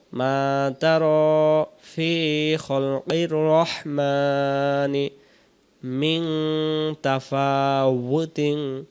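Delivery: slow, drawn-out tajweed chant; Quran recitation. Shown as a demonstration. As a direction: recite it like a qari, very slowly. Recites verse. (ধীর তেলাওয়াতের সুরে)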